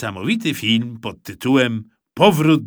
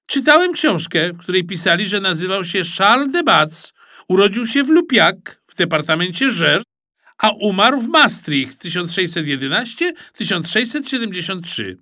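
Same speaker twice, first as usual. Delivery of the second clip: put-on high smug boy reciting dates; teacher's pet showing off, high and pleased; know-it-all pupil; 7 semitones higher, 4 dB louder than usual. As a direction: rattle off the facts proudly. Ananiasz reciting facts smugly.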